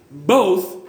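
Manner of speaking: Both said alone and held, falling; stress on both. Shown as a demonstration. Preacher lifting the word Both out for the church, stressed. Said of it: "I look at that word both".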